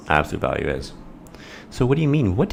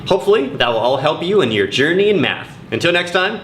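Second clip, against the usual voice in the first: high, bright outro announcer read; show sign-off; 10 semitones higher than usual. Acted. Host signing off Math with [name], announcer voice. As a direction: wrap up the episode like a host.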